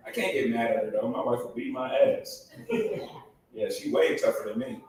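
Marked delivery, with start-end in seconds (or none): none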